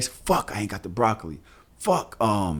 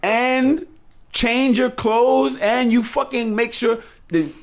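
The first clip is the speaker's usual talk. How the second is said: much higher, exasperated complaint; 13 semitones higher, 3 dB louder than usual. Real, not acted. Dad ranting about cooking three times a day, exasperated. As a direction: sound overwhelmed and incredulous. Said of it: "complaining about cooking".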